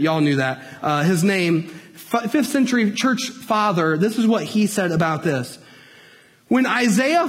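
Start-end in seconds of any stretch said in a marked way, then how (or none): none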